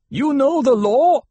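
high, loud, slow reminder; challenging call. A narrator's reading. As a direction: call the law down from the rocks. Voice from rocks challenges the leader.

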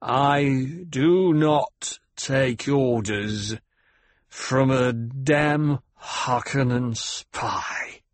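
very slow, over-careful words; deliberate, precisely enunciated defiance; slow, precise enunciation. A narrator's reading. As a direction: spell out each word slowly and carefully. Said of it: "He spoke slowly, with careful and precise enunciation".

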